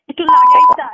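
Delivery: sudden scream. Real, not acted. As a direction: shriek it out in raw anger. Girlfriend screaming during phone quarrel. (হঠাৎ তীব্র চিৎকার)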